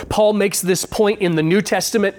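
ordinary delivery

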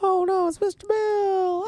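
high, slow wailing cry; Mister Bill voice. Retold or imitated. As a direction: squeal it like the clay puppet. Quoting the SNL skit.

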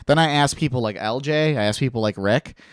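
ordinary talk, nothing marked

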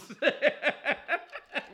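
high amused laugh. Real, not acted. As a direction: laugh at the challenge. Laughs at the bet.

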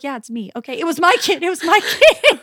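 embarrassed laugh through the words; sheepish laughing admission. Retold or imitated. Mom owning up to her crying kid, laughing sheepishly.

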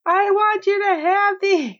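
high-pitched dramatic insistence; mock desperate offering; hammed-up plea. Acting out the thrown-roses plea.

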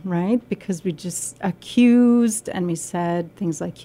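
ordinary delivery

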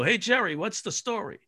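hoog, luid geroepen van achter het toneel; off-stage call. Retold or imitated. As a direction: roep het van opzij de zaal in.

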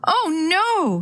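high, drawn-out storybook wail. A narrator's reading. Girl fearing for her cat as the truck passes, narrated wail. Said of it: "she wailed".

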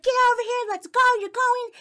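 very high, loud yelled orders; yelling. Retold or imitated. Mom grabbing her child by the arm, yelling. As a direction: imitate a furious mom dragging her kid off. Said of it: "She starts yelling".

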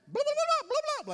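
very high, loud gibberish; mock panic. Student seeing dentist chair, exaggerated panicked babbling. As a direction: babble frantically, hammed-up.

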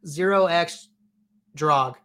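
"zero X Drog" slowly, with a pause before "Drog"; settled pronunciation. Shown as a demonstration. Host settling on a viewer's username, deliberate pronunciation. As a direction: spell out zero and X, then "Drog" as one word. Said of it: "is what I'm going with".

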